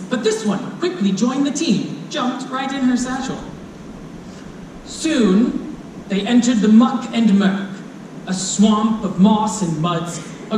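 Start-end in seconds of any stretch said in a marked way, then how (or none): none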